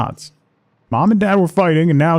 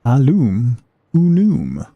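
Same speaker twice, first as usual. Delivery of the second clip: slow motion; slower than usual. Pretend. Joke mangling the word.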